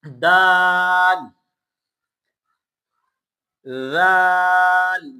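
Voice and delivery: دال then زال drawn out slowly, tongue between teeth; tajweed letter demo. Shown as a demonstration. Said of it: "زال کو نرم پڑھنا ہے".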